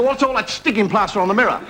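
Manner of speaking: high-pitched mock-accusing question; accusing wife. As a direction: put on a wife demanding an explanation. Wife confronts husband in a joke.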